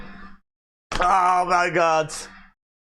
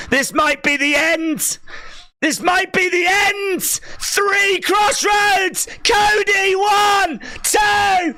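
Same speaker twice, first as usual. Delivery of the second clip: excited shouting; 11 semitones higher than usual. Real, not acted. Streamer shouting the finish to viewers, thrilled. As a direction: shout the action as it happens, thrilled.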